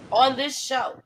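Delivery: usual speaking voice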